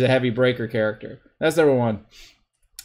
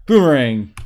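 ordinary talk, nothing marked

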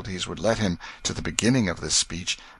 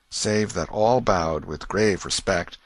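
ordinary delivery

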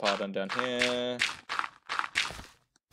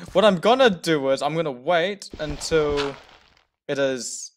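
adrenaline shakes; 4 semitones higher, 6 dB louder than usual. Real, not acted. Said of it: "My heart is racing".

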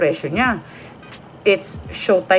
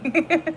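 quick high, soft giggle; short giggle; 7 semitones higher, 5 dB quieter than usual. Real, not acted. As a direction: giggle at your own remark. Laughs after remark. (maikling matinis na hagikhik)

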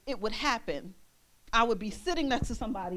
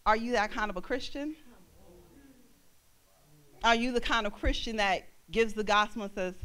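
usual speaking voice

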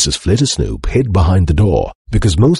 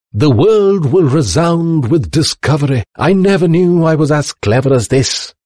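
high-pitched boastful professor; excited inventor professor, proud; 10 semitones higher than usual. A narrator's reading. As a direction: proclaim it high, marvelling at your own genius.

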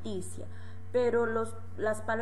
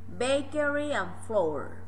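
slow model phrase; 3 semitones higher, 4 dB louder, slower than usual. Shown as a demonstration. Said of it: "Repeat again".

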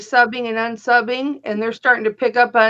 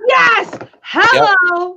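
emphatic; 7 semitones higher, 5 dB louder, much slower than usual. Real, not acted. Emphatic agreement about eBay.

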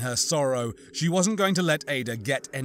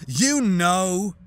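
louder, higher snarled accusation; 6 semitones higher, 6 dB louder than usual. Acted. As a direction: snap the accusation back. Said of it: "Annette snarled".

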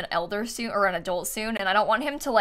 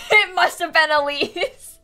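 mock accusation; 6 semitones higher, 4 dB louder than usual. Jokingly blaming Elise.

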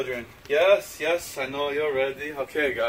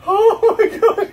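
nervous laugh; 7 dB louder, much slower than usual. Real, not acted. Feeding fish by hand, scared.

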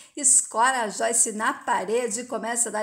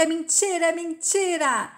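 higher-pitched, understated wail of denial; mildly acted tearful cry; 5 semitones higher than usual. A narrator's reading. Joyce denies Marta's revelation, a restrained tearful cry. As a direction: cry out in protest, lightly acted. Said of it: "Joyce chorando grita".

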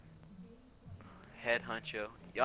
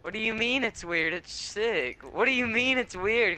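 higher, louder incredulous protest; indignant disbelief; 8 semitones higher, 4 dB louder than usual. Real, not acted. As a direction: push back, offended for the outfit. Defending outfit friend called weird.

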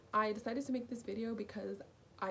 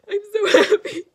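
loud, high, teary laughing joy; overjoyed tears she can't stop; happy crying; 6 semitones higher, 14 dB louder than usual. Real, not acted. Crying happily to the camera after getting in. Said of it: "I know I'm crying".

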